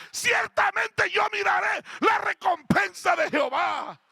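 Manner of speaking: high, loud psalm declamation; impassioned preaching. Acted. Preacher declaring God's protection to the congregation, fervent preaching shout. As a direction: proclaim it with mounting force, nearly shouting.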